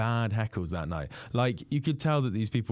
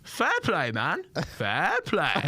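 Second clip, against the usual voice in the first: much higher impersonation, falling on fair play; James Acaster impression; 7 semitones higher, much slower than usual. Heckler answering the comedian in his own voice, high mimicry. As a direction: hit the comic's voice high and dry. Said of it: "what I would imagine James Acaster would".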